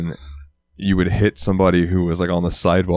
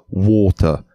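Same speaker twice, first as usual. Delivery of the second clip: imitated British accent. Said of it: "he will just say, like".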